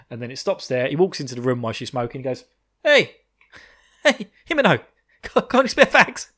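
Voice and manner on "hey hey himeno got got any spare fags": loud, high-pitched hail; hammed-up eager shout; mock calling out. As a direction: call over to a friend to cadge something. Acted walking in, cadging a cigarette.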